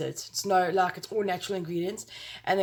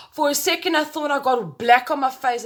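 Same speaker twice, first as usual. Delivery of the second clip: gasp, then high startled words; sudden alarm at the mirror; 6 semitones higher, 6 dB louder than usual. Real, not acted.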